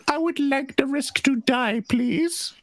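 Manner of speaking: put-on very high squeaky baby voice; terrible baby voice. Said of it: "That was a terrible baby voice".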